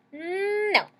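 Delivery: put-on high-pitched childish "No."; a child's shrill no; squeaky refusal. Stephanie refusing mom.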